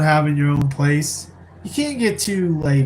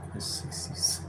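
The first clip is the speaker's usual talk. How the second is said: whispered aside; 15 dB quieter than usual. Real, not acted. Aside under his breath.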